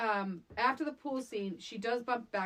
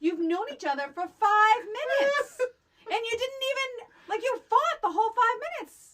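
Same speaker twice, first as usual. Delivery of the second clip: higher and louder, laughing through the words; laughing disbelief; 13 semitones higher, 9 dB louder than usual. Real, not acted. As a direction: crack up in disbelief as you say it. Laughing incredulous rant.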